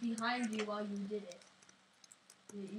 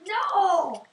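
sharp shouted denial; loud no; 6 semitones higher, 14 dB louder than usual. Pretend. Emphatic denial.

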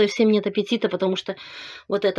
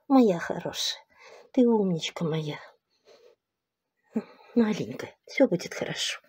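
шёпот. Real, not acted. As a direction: whisper it tenderly. Шепчет собаке. (нежный шёпот собаке)

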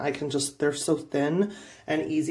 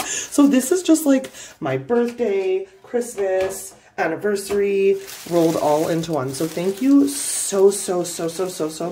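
higher and louder, gushing thanks; excited gushing; 5 semitones higher, 6 dB louder than usual. Real, not acted. Thanking the viewer who sent gifts, gushing excitedly. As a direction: pour out thanks, overjoyed. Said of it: "I'm so excited".

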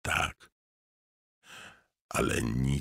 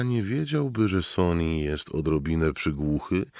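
plain reading voice

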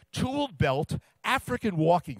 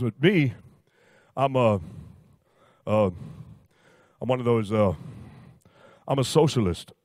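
lower, louder big-man voice; Shaq voice; 6 semitones lower, 4 dB louder than usual. Retold or imitated. Shaq telling Roy how upset he is, in a low booming voice. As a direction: put on a deep, booming Shaq.

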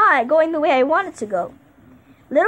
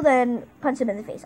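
ordinary talk

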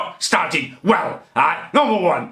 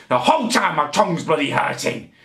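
ordinary talk, nothing marked

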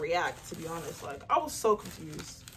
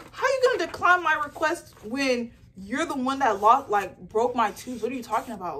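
retold angry complaint; 3 semitones higher, 6 dB louder than usual. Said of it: "getting upset 'cause I was like".

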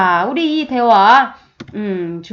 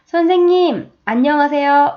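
bright, higher student voice greeting the teacher; cheery student greeting; 5 semitones higher, 4 dB louder than usual. Acted. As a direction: chirp it like a happy student. (밝게 인사하는 학생 목소리)

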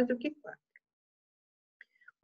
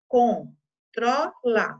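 "Controla" split into very slow separate syllables; 3 dB louder, much slower than usual. Shown as a demonstration. Teacher showing pupils the syllables, very slow. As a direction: sound out "Controla" syllable by syllable, slowly. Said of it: "Na palavra controla".

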